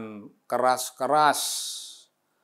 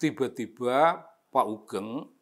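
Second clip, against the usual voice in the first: ordinary talk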